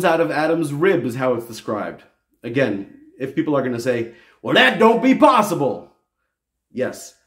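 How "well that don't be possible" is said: a hint of a Southern accent, mocking. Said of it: "the Southern accent".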